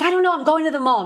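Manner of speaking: high, loud excited answer; giddy reply. Retold or imitated.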